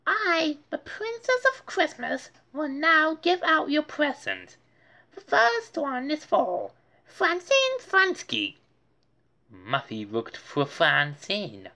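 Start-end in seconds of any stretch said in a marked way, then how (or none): 0.1-8.6 grand announcement. A narrator's reading. Muffy announcing to her guests as princess. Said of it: "Muffy announced".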